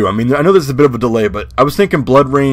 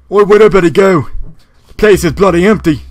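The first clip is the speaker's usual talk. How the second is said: higher, raspy British rocker putting on a show; lightly hammed-up British rocker accent; 5 semitones higher, faster than usual. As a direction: wander the bathroom as a hardcore British rocker. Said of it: "British rocker with, like, a weird, like, raspy voice".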